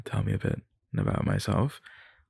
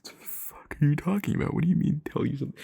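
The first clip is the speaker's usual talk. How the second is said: high, quick, incredulous what-the-hell; baffled retort; 8 semitones higher, faster than usual. Retold or imitated. Caught off guard by an interviewer, baffled. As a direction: act stunned at the question. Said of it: "you're just sitting there like".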